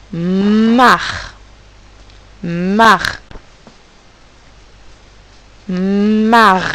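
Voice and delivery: "mar" with each sound stretched, long m; slow, loud "mar" repeated with long pauses; sounds drawn out. Shown as a demonstration. Teacher having pupils count sounds, "mar" stretched sound by sound. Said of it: "coder avec nos ronds les sons".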